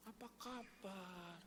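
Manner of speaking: high, soft, slow "Apa kabar?"; a shy child's mumbled greeting; a hint of a timid girl. Daughters' reluctant greeting voiced. (sapaan malu-malu)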